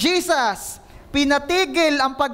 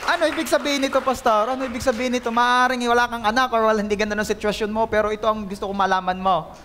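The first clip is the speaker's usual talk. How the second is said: ordinary talk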